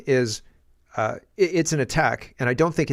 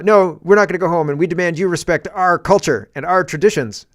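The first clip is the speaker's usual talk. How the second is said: loud, higher-pitched indignant refusal; mock defiant demand; 5 semitones higher, 6 dB louder than usual. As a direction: play a protester loudly digging in. Acted defiant students refusing to leave.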